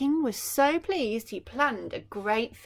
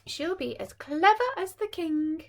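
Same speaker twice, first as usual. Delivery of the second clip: fairy voice; 8 semitones higher, 4 dB louder than usual. A narrator's reading. Fairy granting a wish.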